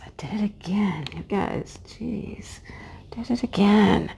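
exasperated hushed whisper; whispered self-scolding; frustrated whisper. Real, not acted. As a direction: sigh it out under your breath in annoyance. Her voice cracks again mid-story.

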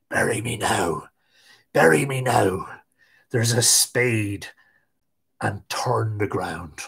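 low, soft, slow corpse whisper. A narrator's reading. Corpse on Tig's back whispering, low and slow. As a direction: breathe it slowly into his ear. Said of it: "whispered in his ear".

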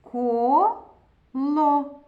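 Коло read as Ко, pause, ло; syllable by syllable. Shown as a demonstration. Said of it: "Прочитаємо".

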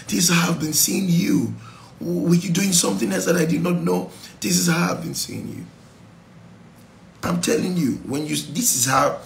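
ordinary delivery

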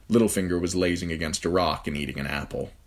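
ordinary delivery

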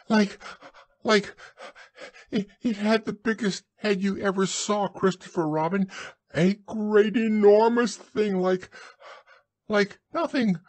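high, breathless, halting squeak; flustered Piglet. A narrator's reading. Piglet describes the monster to Christopher Robin, high and flustered. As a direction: grope for words in high, gasping excitement. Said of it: "breathing so hard that he could hardly speak".

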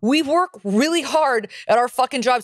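high-pitched, worked-up telling-off; riled-up rant. Real, not acted. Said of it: "Honk's riled right now".

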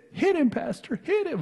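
high, urgent cry to strike back; egging him on to hit; high-pitched deacon urging a fight; urging shout. Retold or imitated. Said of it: "that's literally what was said".

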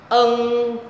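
"Ân" humming through the nose, loud, high and long; nasal throat sound. Shown as a demonstration. Teacher models the nose sound, long nasal "Ân". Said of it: "kết hợp với sống mũi".

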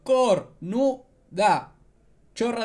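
COR-NU-DA, drawn out syllable by syllable; mock a slow heckle, stretching each syllable of "Cornuda"; imitated heckler's yell. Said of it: "una chica gritando". (grito imitado, «Cornuda» lento y silabeado)

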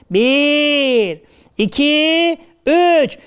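drawn-out count rising in pitch; rousing count-in. Acted. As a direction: build the crowd up to a shout. Toplu bağırış için geri sayım. (giderek tizleşen uzun sayma)

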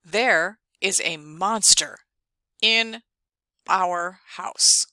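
slow, halting word-by-word reading; slow student reading. Pretend. Teacher playing a student reading the story slowly aloud. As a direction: read it like a beginner sounding out each word.

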